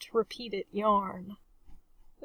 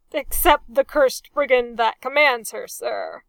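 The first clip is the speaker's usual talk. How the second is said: hasty addition; 2 semitones higher, 10 dB louder than usual. A narrator's reading. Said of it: "Pendersley hastened to add".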